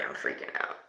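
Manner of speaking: whispered, breathless with fear; frightened whisper. Real, not acted. Medium spooked by the spirit box, whispering her fear. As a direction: whisper it as if the spirit might hear.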